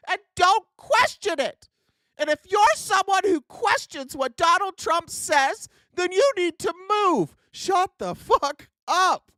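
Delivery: mock the obedient voter, fired up; angry rant. Real, not acted. Ranting angrily at Trump voters.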